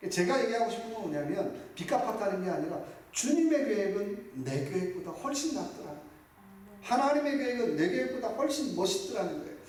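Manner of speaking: usual speaking voice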